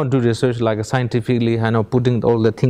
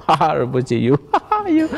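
mocking laugh. Friends teasing Rinpoche about his wife, laughing mockingly. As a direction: chuckle at him as you tease.

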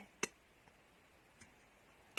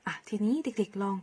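ordinary talk

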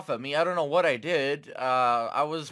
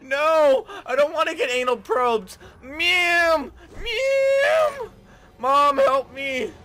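high yelling for help; mock panicked yelling; 11 semitones higher, 7 dB louder, much slower than usual. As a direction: shriek it like a scared kid. Being probed in game.

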